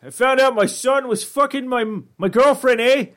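high, put-on Canadian accent; high mock Canadian voice with eh. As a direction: ham up a friendly Canadian guy. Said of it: "everyone should just be Canadian".

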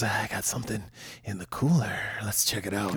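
mock creepy cook, dropped low and hushed. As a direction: lean in low and quiet like a creep luring a kid. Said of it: "he goes".

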